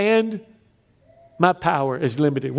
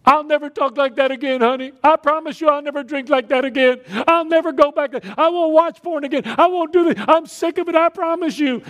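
much higher, urgent vows to a spouse; hammed-up begging, promises tumbling out; frantic pleading promise; 9 semitones higher than usual. Acts out a repentant promise to wife.

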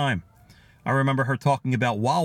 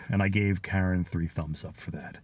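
usual speaking voice

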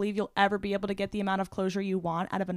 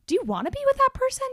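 incredulous; 3 dB louder, faster than usual. Retold or imitated. Mocking the ex's behavior.

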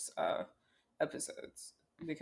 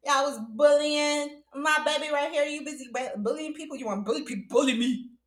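much higher, very loud, laughing put-on voice; Ono's mock threat; 10 semitones higher, 8 dB louder than usual. Ono confronting the hosts who bullied his friend, loud laughing put-on voice. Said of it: "freaking Ono was like".

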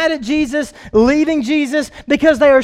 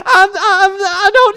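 higher, tearful, pleading not to be left; crying plea; 6 semitones higher, 7 dB louder than usual. Retold or imitated. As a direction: sob it out, begging him to stay. Peter clinging to Jesus, pleading.

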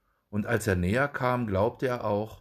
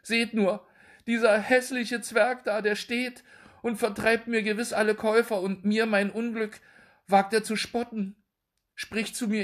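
indignant complaint; 12 semitones higher than usual. A narrator's reading. Mother complains to neighbours.

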